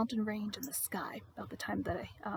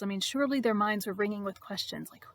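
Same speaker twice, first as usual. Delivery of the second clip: normal talking voice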